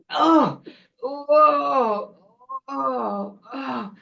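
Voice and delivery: high moans, loud then trailing off; long sick groaning that fades away; troll-witch groans. Acted. Troll witch slinking off unwell, fading high groans. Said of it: "groaning and moving away".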